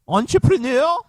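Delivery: "entrepreneur" high and loud with rising end; mock questioning squawk. Host doubting his own word, squawked "entrepreneur".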